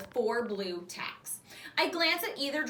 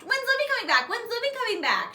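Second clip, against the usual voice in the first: put-on higher, louder child's plea; little girl asking; 13 semitones higher, 5 dB louder than usual. Waitress imitating her small daughter's plea, higher and louder. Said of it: "she would always say".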